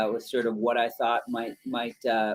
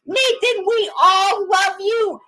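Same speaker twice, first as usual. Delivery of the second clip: Toby voice; 7 dB louder than usual. Acted. Voice actor wishing young fan Nathan happy birthday, high silly Toby voice. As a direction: belt it out as a giddy clown ninja. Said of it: "Toby slash Obito's voice".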